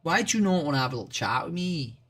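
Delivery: playful put-on Irish lilt, quick; mock Irish accent. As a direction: tease the chat in a hammed-up Irish accent. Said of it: "Have you never heard an Irish accent before?".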